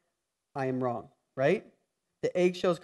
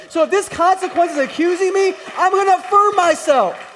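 high, loud, climbing cry of conviction; full-voiced preacher's peak; preaching at full force; 11 semitones higher, 7 dB louder than usual. Real, not acted. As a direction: lift it to a shout of faith.

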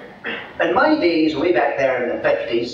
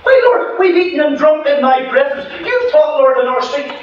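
high, loud desperate begging at the door; pleading crowd; 14 semitones higher, 7 dB louder than usual. Acted. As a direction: cry out to be let in. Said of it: "these people come up and knock".

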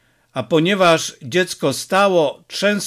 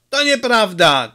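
a higher, mild mock shout; 6 semitones higher than usual. A narrator's reading. Father contradicts his son, a mild mock shout. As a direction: cry out in protest, lightly. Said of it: "krzyknął Taroci".

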